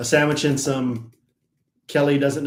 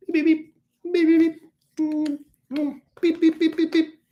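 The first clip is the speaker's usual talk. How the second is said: high little beeps, much higher; playful beeping at the robot Larry; mock robot beeps; 14 semitones higher, faster than usual. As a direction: chirp the beeps like a tiny machine.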